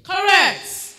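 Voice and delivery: loud, high shout of approval; exclaimed verdict. Real, not acted. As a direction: call it out triumphantly to the hall. Judge announces a right answer.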